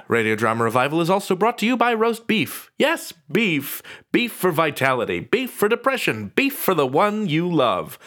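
over-the-top parody sponsor read; mock ad read. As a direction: sell the beef like a cheesy commercial. Mock sponsor ad for roast beef.

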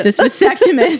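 said through a laugh. Real, not acted. Telling friend about husband's vasectomy, laughing. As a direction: laugh the word out.

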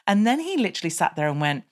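ordinary talk

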